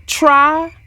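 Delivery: plain reading voice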